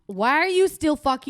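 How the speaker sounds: loud, high-pitched disbelief; exasperated teasing. Real, not acted. Co-host confronting friend still sipping her shake, loudly exasperated. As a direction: call out a friend in playful exasperation.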